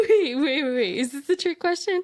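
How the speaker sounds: laughing and confused. Real, not acted. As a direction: crack up in disbelief.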